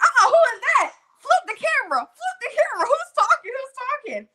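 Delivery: high-pitched, loud hype-friend squawk; outraged friend. Retold or imitated. Friend on FaceTime demanding to see who's talking, outraged. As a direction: imitate a friend butting in, way up high.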